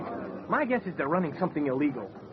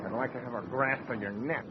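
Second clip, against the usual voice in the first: much lower and softer under-the-breath grumble; muttered aside; 7 semitones lower, 8 dB quieter than usual. Acted. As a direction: mutter the jab so Bois can't hear. Muttered jab behind Bois's back.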